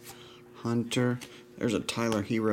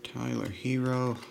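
ordinary talk

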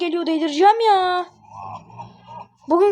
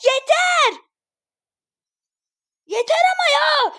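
exasperated high-pitched outburst; fed up; 7 semitones higher, slower than usual. Real, not acted. Gamer on a losing streak has had enough, fed up. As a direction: cry out at the end of your patience.

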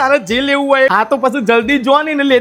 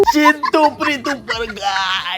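hammed-up shouted wail, higher, slow; mock wailing; 3 semitones higher, slower than usual. Wife wailing to her husband over the lost prize, hammed-up crying.

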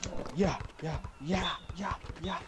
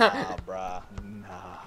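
lower, lazy brush-off; dismissive player; 6 semitones lower, 4 dB louder, much slower than usual. Retold or imitated. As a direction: wave it off like a cocky player. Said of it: "your guy's like".

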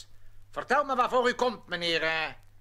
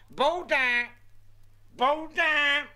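calls out louder for the messenger; calling out; 6 semitones higher, 3 dB louder than usual. Acted. Mayor calls his messenger, a loud summons. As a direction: shout for the messenger across the hall.

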